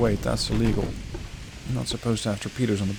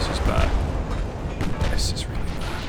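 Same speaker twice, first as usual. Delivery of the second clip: dread-filled whisper. Real, not acted. Collision imminent, dread.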